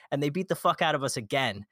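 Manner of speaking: ordinary talk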